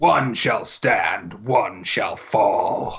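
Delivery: slow, low dramatic movie-villain line; movie villain quote. Retold or imitated. Friends quoting the cartoon movie, slow and ominous.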